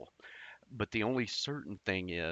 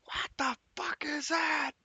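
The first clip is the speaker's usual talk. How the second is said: put-on high, shrieking cry of a scared kid; a small boy's panicked shriek at a demon; terrified child shriek. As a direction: shriek it like a frightened five-year-old. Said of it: "I start screaming".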